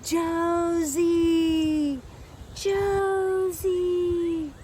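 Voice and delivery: high, drawn-out eerie call; calling out a name. A narrator's reading. Creature hunting the girl, calling her name. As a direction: call the name through the dark woods. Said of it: "calling out, calling my name".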